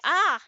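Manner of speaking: a sudden loud high yelp of alarm; startled yelp. Real, not acted. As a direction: jolt out a sharp cry as the game surprises you. Sudden mishap while playing game.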